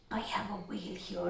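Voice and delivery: hushed secret whisper. Shown as a demonstration. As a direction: whisper as if revealing a surprise. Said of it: "other techniques".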